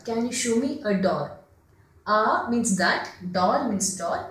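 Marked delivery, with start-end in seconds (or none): none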